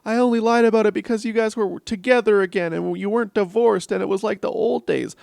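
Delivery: hammed-up teen pleading; mocking a daughter's tearful excuse; mock whiny daughter. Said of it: "she's like".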